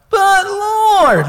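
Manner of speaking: very high, loud, drawn-out mock whine; exaggerated whine. Preacher redoing Gideon's objection to God, hammed-up whine. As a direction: overdo the squealing protest. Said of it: "you gotta have a little bit of the, eh".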